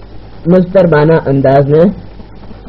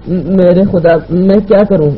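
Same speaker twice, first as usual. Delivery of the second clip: distressed, higher-pitched stammer; choked up; 4 semitones higher than usual. Acted. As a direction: despair as if close to tears. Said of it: "کٹی بھرائی ہوئی آواز میں بولی". (بھرائی ہوئی آواز میں)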